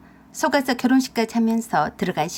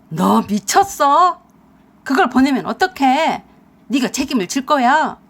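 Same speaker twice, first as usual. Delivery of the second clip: furious yell; 6 dB louder than usual. Acted. Husband furious at wife, loud yelling. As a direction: scream it at her in fury.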